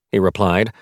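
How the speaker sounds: plain reading voice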